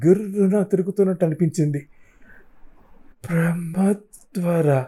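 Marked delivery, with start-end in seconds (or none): 3.3-4.8 slow, breathless whisper of disbelief; stunned whisper. Acted. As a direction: breathe the name out slowly, in shock. Stunned narrator whispers the name.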